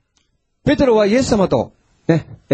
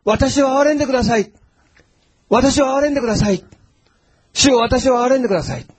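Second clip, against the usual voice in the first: crying out; 7 semitones higher than usual. Retold or imitated. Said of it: "叫んだんですね".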